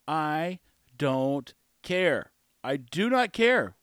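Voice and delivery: very slow, word by word; deliberate, drawn-out refusal; emphatic. Real, not acted. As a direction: space out each word for emphasis. Emphatic answer to the objector.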